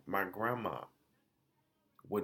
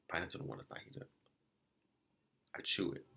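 whisper; 4 semitones lower, 9 dB quieter, much faster than usual. Real, not acted. Retelling the first bite.

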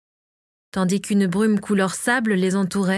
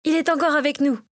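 higher voice for the cook's wife; called-out announcement; 6 semitones higher than usual. A narrator's reading. Natacha has just found a pulse, called-out announcement. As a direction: announce it promptly in a lifted voice. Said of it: "lança-t-elle après avoir trouvé un pouls".

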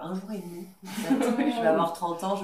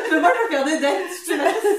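much higher and louder, laughing through it; laughing mock; 10 semitones higher, 13 dB louder than usual. Friend laughingly mocks the vacation plan, high teasing laughter. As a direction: tease the friend's plan while cracking up.

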